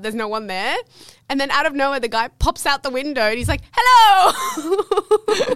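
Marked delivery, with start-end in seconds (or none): 3.7-5.5 high, loud drawn-out hello; calling out. Retold or imitated. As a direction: imitate a man hollering down from a window. Retold man calling from window.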